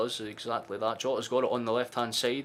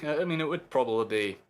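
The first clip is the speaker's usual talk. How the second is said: usual speaking voice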